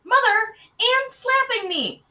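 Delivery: high, loud wail for mother; outraged little-girl complaint; tattling child. A narrator's reading. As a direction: tell on your sister at full pitch. Child tells on sister.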